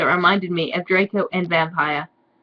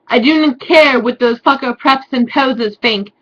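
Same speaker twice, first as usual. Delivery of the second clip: hammed-up Draco; 4 semitones higher, 7 dB louder than usual. Draco's declaration.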